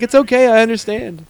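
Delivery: high-pitched put-on mom; understanding mom. Mom answering dad's speech, understanding. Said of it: "your mom's like".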